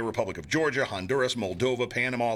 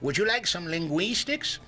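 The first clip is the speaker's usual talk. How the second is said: playful waiter voice; 5 semitones higher than usual. Pretend. Joking waiter offering linguistics.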